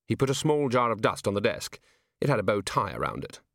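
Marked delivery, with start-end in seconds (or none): none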